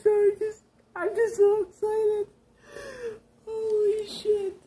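high, tearful voice, choked with happy crying; overwhelmed and weeping with joy; real happy sobs breaking through the words; crying tears of joy. Real, not acted. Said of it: "I'm trying my best not to cry tears of joy".

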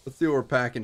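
ordinary talk, nothing marked

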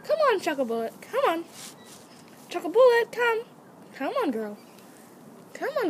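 high, repeated coaxing call to an animal; calling a pet. Shown as a demonstration. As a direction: call your pet over in short high phrases. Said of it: "starts saying".

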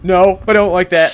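loud, high, scared protest; panicked. Real, not acted. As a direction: whine it out in fright. Panicked reply to taunt.

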